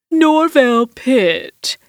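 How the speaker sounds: slick, oozing drawl; oily villain. A narrator's reading. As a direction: stretch the name out unctuously. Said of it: "voice that reminded me of light crude oil spreading".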